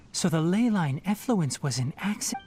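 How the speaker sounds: ordinary delivery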